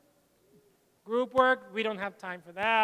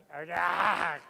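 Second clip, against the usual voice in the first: laugh; 5 semitones lower than usual. Real, not acted. Teacher laughs at own punchline.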